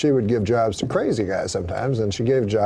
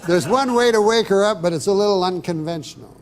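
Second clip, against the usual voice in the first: doctor voice; 6 semitones higher, 4 dB louder than usual. Retold or imitated. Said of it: "He says to me".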